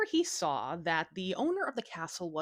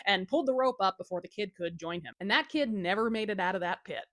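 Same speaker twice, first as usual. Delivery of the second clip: ordinary delivery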